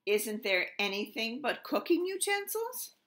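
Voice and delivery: impatient king. Acted. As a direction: whine it like a spoiled king.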